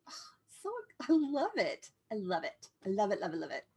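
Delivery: ordinary talk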